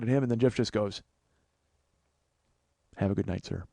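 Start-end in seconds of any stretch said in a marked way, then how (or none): 3.0-3.6 low, soft imitation of a humbled retreat; meek farewell. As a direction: say it small and polite, as imitation. Humbled friend retreats politely from clerk.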